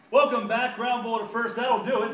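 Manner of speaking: very loud, high mock broadcast; frantic radio call. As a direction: shout the whole inning in one burst. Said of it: "that inning on radio was".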